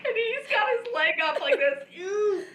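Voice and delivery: high-pitched laughter through words; cracking up while telling it; laughing. Real, not acted. Laughing hard at the memory.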